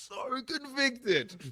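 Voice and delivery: high, drawn-out exclamation through a laugh; overwhelmed, laughing. Retold or imitated. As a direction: stretch it out high, half laughing. Recalls being struck by hell sermon.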